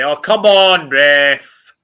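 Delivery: loud protest at the ref; yelling from the sidelines. Real, not acted. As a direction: shout it at the referee in disbelief. Protesting a foul at the referee.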